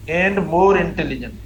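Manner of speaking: slow, stretched words; slow dictation. Shown as a demonstration. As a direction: dictate for the class to copy. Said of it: "teacher dictating". (धीरे-धीरे लिखवाना)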